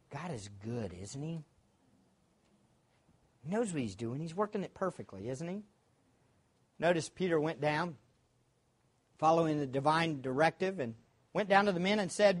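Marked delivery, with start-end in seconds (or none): none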